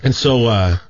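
ordinary talk, nothing marked

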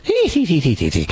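a light, silly Irish giggle; imitated Irishman giggle. Coworker's impression of an Irishman, a giggly mock Irish laugh. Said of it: "his impression of the Irishman".

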